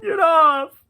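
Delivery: very high, loud cry; one last desperate yell; frazzled shout. Retold or imitated. Frazzled Celia rolls Sam off.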